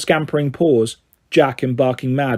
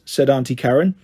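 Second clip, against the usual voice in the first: usual speaking voice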